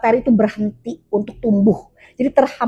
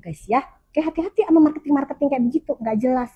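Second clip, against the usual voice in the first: ordinary delivery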